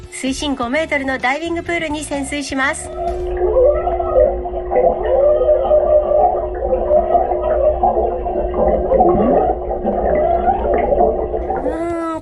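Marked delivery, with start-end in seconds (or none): 2.9-12.2 muffled, high voice through water; talking underwater at depth. Real, not acted. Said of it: "これもちょっと判別不可能".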